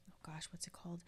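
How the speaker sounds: muttered to herself in a whisper; whispered aside. Real, not acted. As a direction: whisper while searching memory. Trying to recall the film.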